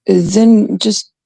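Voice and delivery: usual speaking voice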